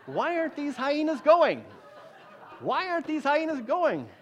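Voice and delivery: high-pitched mock bewilderment; baffled complaint. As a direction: whine it, acting out someone getting nowhere. Voicing frustrated failed devil-resister.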